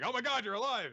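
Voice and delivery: high-pitched, hammed-up shock; mock astonishment. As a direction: exclaim as if seeing the dead alive. Mourners see he is alive.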